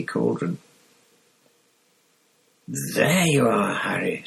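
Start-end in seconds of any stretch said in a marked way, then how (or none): 2.7-4.2 put-on slow, measured older man; unhurried official, relieved. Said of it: "said a voice".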